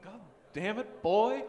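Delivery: Texan cartoon character impression; King of the Hill accent. [name] does King of the Hill on request, Texan character voice. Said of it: "do your best accent from King of the Hill".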